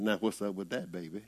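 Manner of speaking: a light, low voice of God turning the question around; God answering back. Retold or imitated. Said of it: "Lord, come right back with the answers".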